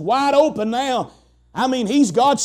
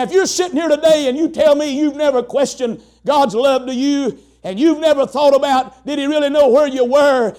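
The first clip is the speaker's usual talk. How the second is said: normal talking voice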